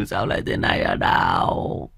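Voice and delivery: whispered, choked lament; broken whispered cry. Acted. Mother's grief collapses into a choked whisper. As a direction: let the wail collapse to a breathless whisper. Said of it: "rú lên khóc to hơn".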